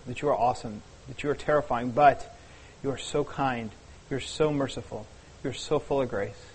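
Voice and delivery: soft, low prayerful voice. Real, not acted. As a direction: pray quietly and reverently. Opening prayer before the sermon.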